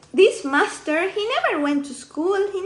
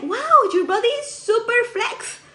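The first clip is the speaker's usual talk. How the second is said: much higher, swooping wow of admiration; impressed exclamation; 8 semitones higher than usual. Retold or imitated. Master to new student testing flexibility, impressed. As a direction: imitate an amazed coach praising someone.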